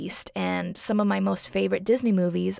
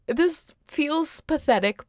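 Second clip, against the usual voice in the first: self-mocking; 8 semitones higher, slower than usual. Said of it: "I'm just like".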